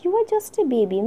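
exclaiming. Retold or imitated. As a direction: cry it out, amazed. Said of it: "Miss Betsy exclaimed that".

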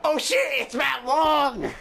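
mock-grand Japanese-accented roll call; horrible Japanese accent. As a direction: announce each name, stretched, in a fake Japanese accent. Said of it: "doing a horrible Japanese accent".

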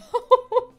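real embarrassed giggle; high laugh. Real, not acted. Laughs after apologizing.

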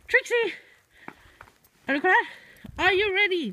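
high, loud call to a pet; excited calling. Real, not acted. Calling Trixie, are you ready, excited high call. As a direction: rev the dog up to play.

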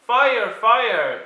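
high, loud call of fire; alarm call. Acted. Luther raising a mock alarm, high and loud. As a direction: raise the alarm.

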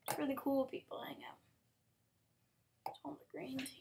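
soft unvoiced whisper. Real, not acted. Gamer whispering an aside to viewers, softly. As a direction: breathe it like a secret.

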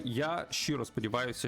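ordinary talk, nothing marked

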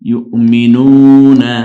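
ইউমিনুনা loud, very slow, with a long oo; drawn-out vowel. Shown as a demonstration. Teacher demonstrates one-alif stretch, drawn-out vowel. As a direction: pull out the নু in ইউমিনুনা. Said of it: "টানবো আমরা".

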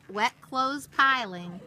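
ordinary delivery

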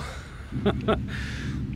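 breathy, quiet laugh under the breath; an amused little snicker; a hushed private laugh; soft chuckle. Acted. Jack amused at Lucifer's question.

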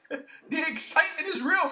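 genuinely thrilled, can't hold it in; real excitement. Real, not acted. Podcaster gushing to his co-host about the leaked Godzilla picture, excited. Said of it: "you could hear it in my voice".

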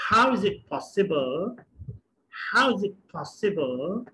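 drawn-out teaching delivery; slow model sentence. Shown as a demonstration. Teacher repeating the sentence slowly for students. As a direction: say each word slowly and clearly. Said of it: "teacher models sentence slowly".

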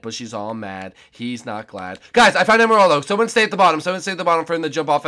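ordinary talk

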